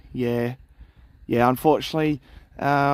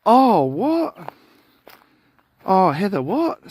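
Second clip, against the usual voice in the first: surprised; 5 semitones higher, 4 dB louder than usual. Real, not acted. Shocked at stolen YouTube name.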